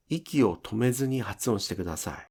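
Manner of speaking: ordinary delivery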